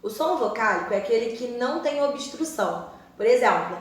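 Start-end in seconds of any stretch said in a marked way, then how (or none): none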